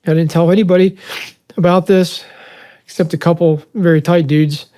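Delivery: real upset, halting after crying; choked up. Real, not acted. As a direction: push on through the tears in short bursts. Carries on after breaking down.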